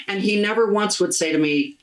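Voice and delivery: ordinary talk, nothing marked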